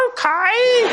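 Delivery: very high, squeaky agreement; reluctant okay. Retold or imitated. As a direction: agree in a hammed-up tiny high voice. Awkward agreement to odd bedroom request.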